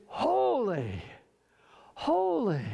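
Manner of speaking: HOLY drawn out high, falling away; emphatic holy. Shown as a demonstration. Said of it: "I can't yell out enough to emph-, emphasize it enough".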